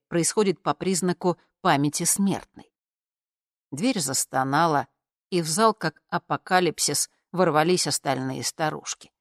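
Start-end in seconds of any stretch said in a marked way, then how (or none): none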